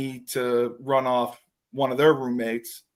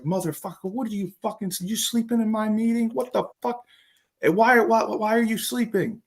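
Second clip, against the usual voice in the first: higher-pitched rant, climbing as he goes; angry coach; 7 semitones higher than usual. Retold or imitated. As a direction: chew him out, pitch rising line by line. Coach yells at sleeping player.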